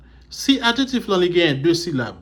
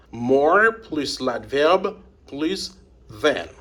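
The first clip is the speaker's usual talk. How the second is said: slow dictation; much slower than usual. Shown as a demonstration. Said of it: "dictates formula slowly".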